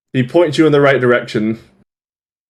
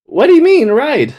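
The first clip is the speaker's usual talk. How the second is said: put-on high-pitched questioning kid voice; puzzled young boy; 12 semitones higher than usual. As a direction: squeak it like a confused twelve-year-old. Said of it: "like, twelve".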